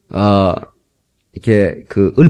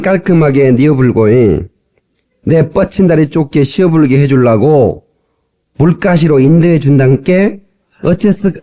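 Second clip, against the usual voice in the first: Jeolla dialect psalm recital; 전라도 사투리 낭송; 2 semitones higher than usual. Shown as a demonstration. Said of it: "전라도 버전을 제가 따왔습니다". (구수한 전라도 사투리로 시편 낭송)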